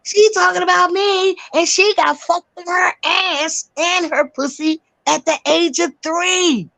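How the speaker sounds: high mocking announcement; mimicking his taunting outcry; jeering imitation. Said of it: "That's how he said it".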